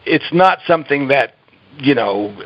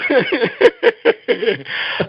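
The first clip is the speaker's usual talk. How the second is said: high, uncontrolled real giggling; helpless laughter; 10 semitones higher than usual. Real, not acted. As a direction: lose it laughing mid-sentence. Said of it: "I'm sorry for laughing".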